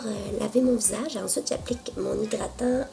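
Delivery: ordinary delivery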